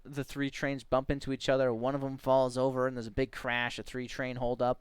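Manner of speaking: ordinary talk, nothing marked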